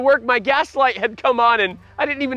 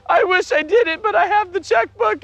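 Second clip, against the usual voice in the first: wife's voice; 10 semitones higher than usual. Retold or imitated. Husband voicing his wife about the checkbook in a much higher voice. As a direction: squeak it up as his wife answering.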